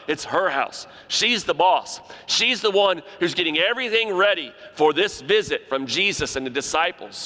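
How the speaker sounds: usual speaking voice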